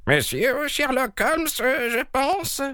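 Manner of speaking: put-on very high, slow old-woman voice; elderly maidservant. A narrator's reading. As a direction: question in a drawn-out, high-pitched character voice. Voices the elderly maid in story.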